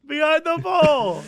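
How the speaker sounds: high, loud, slow mock yell; mock shout. Friend repeating the punchline, loud slow mock shout. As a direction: echo the catchphrase gleefully. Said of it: "friend echoes the story".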